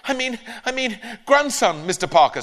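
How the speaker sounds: high flustered correction; flustered boy. Acted. Ben nervously correcting himself to the neighbour. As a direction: scramble to fix the lie.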